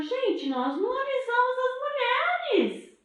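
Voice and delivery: high alarmed father voice; father suddenly remembering the women. Acted. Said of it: "E o pai falou". (pai alarmado com voz aguda)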